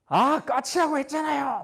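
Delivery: rude impatience. Retold or imitated. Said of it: "뭐 이렇게 아주 버릇없는".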